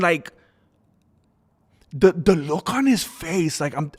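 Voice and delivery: ordinary talk, nothing marked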